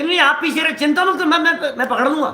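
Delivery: acted insistent reply. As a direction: play someone insisting they will catch up. Acting out the walker's reassuring reply.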